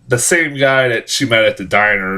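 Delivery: usual speaking voice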